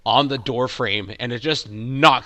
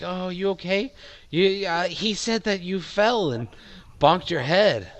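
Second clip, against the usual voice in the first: lightly voiced woman, a little higher; mildly acted higher voice of concern; concerned lady; 6 semitones higher than usual. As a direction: gently check on someone hurt, pitched up. GM voices lady comforting hurt man.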